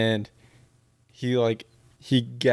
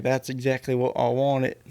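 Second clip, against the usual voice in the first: put-on half English, half Southern accent; muddled Southern-English accent; botched Southern accent. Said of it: "half English, half Southern accent".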